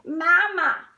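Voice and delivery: high, loud little chick calls; baby goslings. Acted. Goslings calling Bruce their mother, high loud baby calls. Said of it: "they're all saying".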